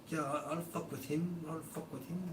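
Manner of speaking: put-on a touch of English slang accent; mock English accent. Mocking Sam's English accent, high and light. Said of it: "That sounded a little Irish".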